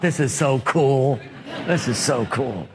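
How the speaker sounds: low, hushed mock marvel at his own mischief; lightly voiced younger self. Retelling own childhood thrill.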